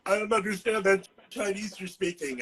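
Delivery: put-on voice of a puzzled friend; baffled Southern friend. Southern friends mistaking his slang for Chinese, baffled imitation. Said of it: "my Southern friends go".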